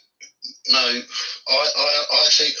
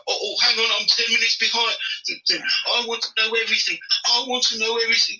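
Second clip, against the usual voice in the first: high, whiny mock voice; sarcastic high-pitched mimicry of a viewer; mocking impression; 11 semitones higher, 4 dB quieter, faster than usual. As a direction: mimic an impatient fan, fussing. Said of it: "He's like".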